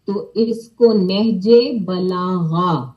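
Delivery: slow, with a throaty غ in بلاغہ; clear ghain. Shown as a demonstration. Teacher showing children the غ in بلاغہ, slowly. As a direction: bring out the غ sound in بلاغہ. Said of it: "غ، غین کی sound ہے".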